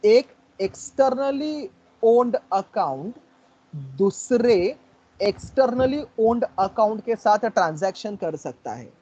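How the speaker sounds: each word laid out slowly; slow teacher's dictation. Shown as a demonstration. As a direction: spell it out word by word for the class. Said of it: "slow dictation of definition".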